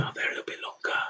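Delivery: quick hushed whisper to himself. Real, not acted. Gamer whispering to himself while lingering on the defeat screen. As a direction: whisper it fast, almost to yourself.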